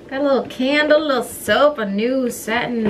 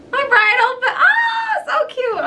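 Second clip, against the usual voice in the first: very high, excited squeal; giddy delight; 6 dB louder than usual. Real, not acted. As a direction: burst out gushing with a laugh in it. Gushing over bridal gifts.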